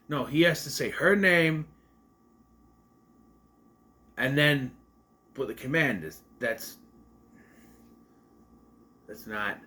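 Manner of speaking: ordinary delivery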